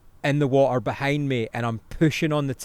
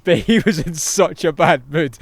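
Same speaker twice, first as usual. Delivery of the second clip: usual speaking voice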